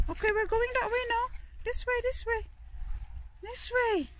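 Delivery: high, light pet-talk voice; soft high-pitched coaxing; coaxing a dog. Real, not acted. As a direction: steer the dog along gently. Steering dog on the walk.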